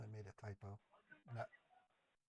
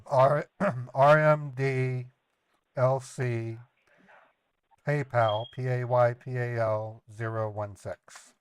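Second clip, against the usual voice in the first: dictating slowly; 3 semitones higher, 7 dB louder, slower than usual. Real, not acted. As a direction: spell each letter back slowly and clearly. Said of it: "could you read it back to me".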